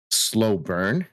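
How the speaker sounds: ordinary talk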